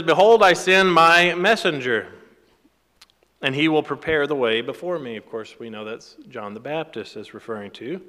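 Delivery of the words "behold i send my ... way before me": louder, emphatic Bible reading; scripture reading. Acted. Preacher reads prophecy to congregation, loud scripture reading. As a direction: proclaim the verse, raised in volume.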